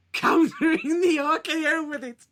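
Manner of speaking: high, loud exclamation; incredulous outburst. Real, not acted. Host recaps the RKO counter to co-host, incredulous outburst. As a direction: blurt it out, amazed at the counter. Amazed at the match finish.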